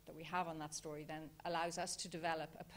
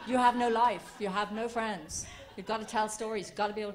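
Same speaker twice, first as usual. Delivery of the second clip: emphatic; 3 semitones higher, 11 dB louder than usual. Acted. Mock-dramatic joke about non-storytellers.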